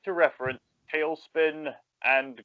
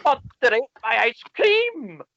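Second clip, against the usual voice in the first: much higher cartoon voice; squeaky cartoon kid line; cartoon character quote; 9 semitones higher than usual. Third speaker repeats quote.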